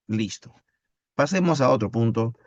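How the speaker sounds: usual speaking voice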